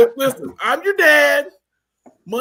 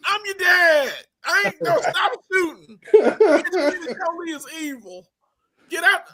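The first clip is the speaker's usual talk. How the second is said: mock villain dad; 7 semitones higher, 4 dB louder than usual. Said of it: "And I'm like".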